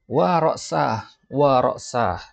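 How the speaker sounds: slow, high groaning wa ra'sah; mock cry of pain. Zubair cries out about his headache in class, mock pain. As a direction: cry out as if the head aches. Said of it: "Zubair teriak".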